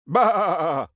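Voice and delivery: loud dying sheep's bleat; a sheep's last bleat, shouted. Acted. Narrator voicing a sheep dying in a blood cloud, loud bleat. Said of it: "were the sheep's last words".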